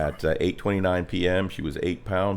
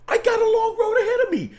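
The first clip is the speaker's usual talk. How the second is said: much higher, faster, laughing near-shout; mock outburst; 5 dB louder, faster than usual. Quoting himself at the counter, high laughing yell. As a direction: blurt it out in hammed-up outrage.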